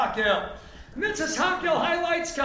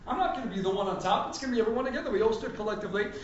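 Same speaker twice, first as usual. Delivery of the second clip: ordinary delivery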